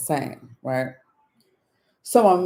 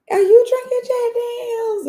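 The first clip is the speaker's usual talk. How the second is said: very high, put-on squeal; squeaky mock flirting; flirty admirer; 4 dB louder than usual. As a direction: mimic a fawning admirer way up high. Mimics admirers flirting with the man.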